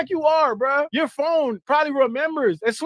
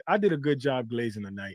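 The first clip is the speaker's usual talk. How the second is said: lower, softer smug mock voice; mock superfan; 14 semitones lower, 7 dB quieter than usual. Mocking the fan as he gets home after the stream, smug and low. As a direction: mumble it like a proud little fan. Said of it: "and be like".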